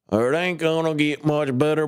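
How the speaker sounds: milder mock yokel grumbling; lighter put-on yokel voice. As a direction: ease off the silly yokel voice, keep a hint of it. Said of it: "I don't know why I'm talking like this".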